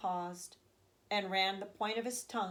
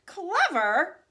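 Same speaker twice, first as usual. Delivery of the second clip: high, loud indignant cry; cried out; 9 semitones higher, 10 dB louder than usual. Acted. Old grandfather to Charlie, crying out loud and high. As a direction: throw the word back, outraged. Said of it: "cried the old man".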